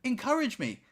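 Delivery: high, pressed plea; pleading. Retold or imitated. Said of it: "I was like".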